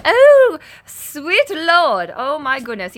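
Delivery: high, loud opening that settles down; excited exclamation. Real, not acted. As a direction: exclaim in delighted shock, starting high. Reacts to British accent comment.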